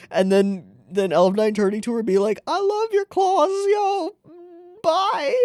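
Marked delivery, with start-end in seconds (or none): 2.5-5.4 put-on high, crying girl voice; tearful goodbye. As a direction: say goodbye through tears. Voicing Elf Nine, tearful.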